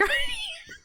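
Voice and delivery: high squealing burst of laughter; shrieking laugh. Real, not acted. Cracking up at Barty hunting the cat, shrieking laugh. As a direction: let a shrill laugh burst out.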